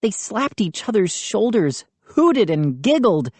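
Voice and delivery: usual speaking voice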